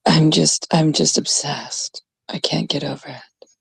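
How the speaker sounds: low, breathy whisper of mock infatuation; mock swooning whisper. Teasing Americans, whispering as if infatuated. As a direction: swoon over the accent in a whisper.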